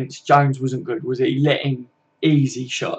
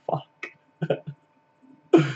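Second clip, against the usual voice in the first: a real laugh breaking into stunned disbelief; laughing what-the-hell reaction. Real, not acted. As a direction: react as if the comment can't be serious. Reads a comment about eating flowers.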